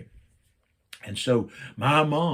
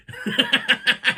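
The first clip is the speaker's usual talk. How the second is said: burst of laughter; 12 semitones higher, 5 dB louder than usual. Real, not acted. Laughing at dad's reaction.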